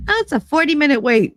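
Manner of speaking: high, quick, chirpy mock hostess; mimicking a restaurant host, high and fast. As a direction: parody a cheerful host announcing the wait.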